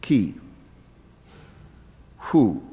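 ordinary delivery

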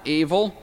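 "Evil" with the accent on "E", second syllable light; stress on first syllable. Shown as a demonstration. Teacher shows Hebrew noun stress, first syllable hit. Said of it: "Note the accent on the first syllable".

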